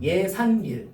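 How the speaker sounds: 예산일 as ye-san-nil, with a double n; demonstrated pronunciation. Shown as a demonstration. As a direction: hold the added n in 예산일. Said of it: "이것도 어떻게 되죠?". ("예산닐"로 니은 둘을 살려서)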